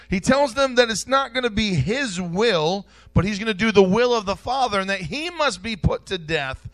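loud, high fervent preaching; preaching at full force. Real, not acted. Preacher tells the church of Jesus' death, loud and high. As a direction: drive it home to the church.

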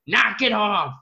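high-pitched mock dad snap; imitated angry yell. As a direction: imitate a father losing patience. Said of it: "Robert's getting a little angry".

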